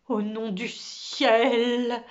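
slow, drawn-out exclamation of astonishment; stunned dismay. Acted. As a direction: stretch the words out in disbelief. Director sees huge cupboard approaching him. (stupéfaction, mots très étirés)

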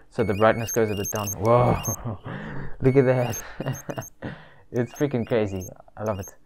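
muffled behind fabric mask; through a face mask. Real, not acted. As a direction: voice under covered mouth.

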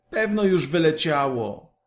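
high, brushing her off; a lightly voiced impatient boy. A narrator's reading. Kaj waves Gerda away, high and impatient. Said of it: "rzekł Kaj niecierpliwie".

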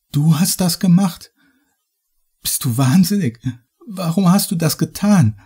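high-pitched, agitated accusation; appalled disbelief. A narrator's reading. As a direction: demand an explanation in shock. Victim confronts captor in audiobook.

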